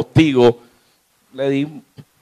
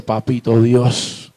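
slow, low address to God; pleading prayer; 4 semitones lower, much slower than usual. Acted. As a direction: pray slowly and low, as a child to Father. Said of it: "te arrodillas y le pides". (súplica lenta y grave a Dios)

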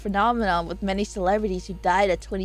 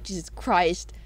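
ordinary talk

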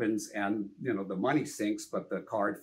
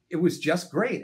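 ordinary talk

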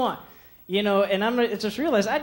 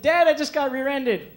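panicked call; 6 semitones higher, 4 dB louder than usual. Retold or imitated. Phoning dad after the crash.

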